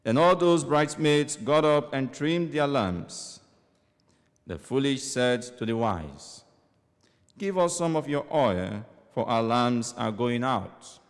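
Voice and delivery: solemn proclaimed scripture; gospel reading. Acted. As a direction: proclaim it like a church lector.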